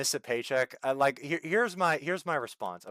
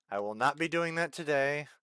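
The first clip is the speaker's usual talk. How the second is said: normal talking voice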